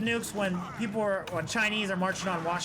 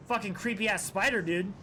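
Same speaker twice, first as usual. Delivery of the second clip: normal talking voice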